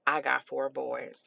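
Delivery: low, soft, slow-paced reassurance; understated, unfazed woman's aside; imitating reassuring cashier. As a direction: drop down low and quiet, mother to mother. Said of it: "And she was like".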